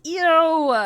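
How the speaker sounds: loud, high, grossed-out Ew!; disgusted ew. Real, not acted. Streamer reacting to mukbang noises, grossed out. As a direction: recoil from the gross sound.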